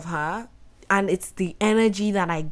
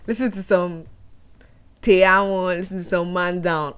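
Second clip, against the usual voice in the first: ordinary delivery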